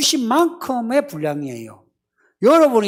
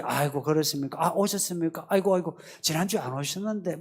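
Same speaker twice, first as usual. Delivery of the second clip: softer, fast, indulgent pampering tone; fawning welcome; 4 semitones lower, 8 dB quieter, faster than usual. Retold or imitated. Church staff fussing over a long-time member who plays new. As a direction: coddle a complaining member. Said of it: "이러지요".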